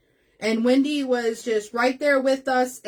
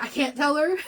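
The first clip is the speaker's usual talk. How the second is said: retold protest dissolving into high laughter; amused, laughing self-quote; 4 semitones higher than usual. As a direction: say it through a laugh. Retells keeping secret, breaks into laughter.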